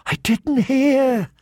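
high, slow, fearful plea; frightened. A narrator's reading. Boy waking to a looming shadow, frightened. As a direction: draw it out high, trembling in fear. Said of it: "he whispered, trembling in fear".

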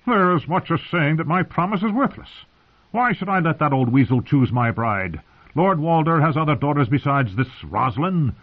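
high-pitched, indignant outburst; seething with wounded pride. A narrator's reading. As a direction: protest hotly, pitch right up. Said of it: "Edmure was seething".

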